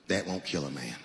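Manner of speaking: very low, soft clerk voice; deadpan gun clerk. Acted. Gun clerk warning the buyer, low deadpan. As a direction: drop it low and matter-of-fact. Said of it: "he goes just like this".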